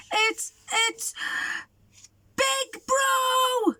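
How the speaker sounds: stammer, then a very high shout; terrified shriek. Acted. Mouse shrieks as Big Bro arrives. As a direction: shriek the name in fright. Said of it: "shrieked the mouse".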